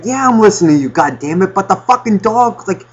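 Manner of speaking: high, flustered, rushed parent voice; a harried dad distracted mid-call; frazzled parent. Flustered dad cutting short a phone call with a friend.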